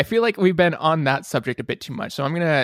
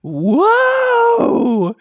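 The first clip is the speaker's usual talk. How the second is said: high, loud game-show whoa; big surprised whoa like a host; excited whoa; 6 dB louder than usual. Real, not acted. Host's game-show exclamation.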